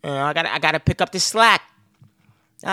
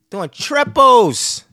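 drawn out very slowly, louder and higher; stressing how long "Doing triples" are; emphatic; 7 semitones higher, 9 dB louder, much slower than usual. Real, not acted. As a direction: lean hard on every syllable of "Doing triples". Stressing his partner's long shifts.

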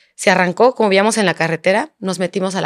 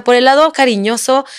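ordinary delivery

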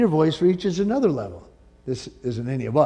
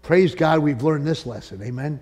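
normal talking voice

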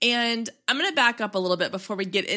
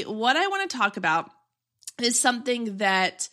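ordinary delivery

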